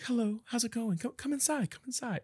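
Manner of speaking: high, soft welcoming little gnome voice; super nice little man. Retold or imitated. Tiny host inviting guests in, high and sweet. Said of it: "And he's like".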